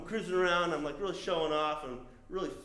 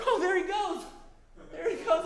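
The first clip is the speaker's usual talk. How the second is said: high, laughing defeat; high laughing resignation; 4 dB louder than usual. Retold or imitated. Cyclist watching his fit buddy ride off, high and laughing. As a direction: wave him off with a rueful laugh.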